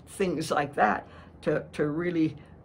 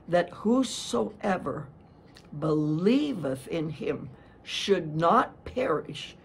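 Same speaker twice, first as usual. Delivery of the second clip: slow recited verse. Shown as a demonstration. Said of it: "John three sixteen".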